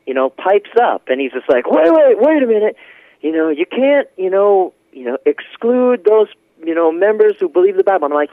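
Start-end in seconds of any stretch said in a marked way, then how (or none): 1.7-8.1 high, loud wait wait, pushing in to object; urgent objection. Retold or imitated. Man at the back cuts in to object, loud and high. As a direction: cut in urgently, higher and louder, then settle into the point.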